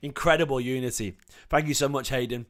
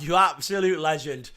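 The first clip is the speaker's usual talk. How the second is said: ordinary talk, nothing marked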